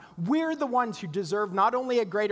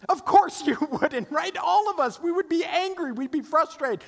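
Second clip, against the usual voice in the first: emphatic outburst; 10 semitones higher, 5 dB louder than usual. Real, not acted. Preacher rebuts sarcasm emphatically.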